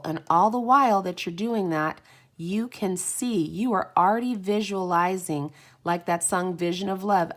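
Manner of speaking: usual speaking voice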